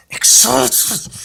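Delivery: loud, high, distorted burst on "excited"; hammed-up excitement. Vlogger hyping the comics look-through, hammed-up excitement. Repeats the word louder, hammed up.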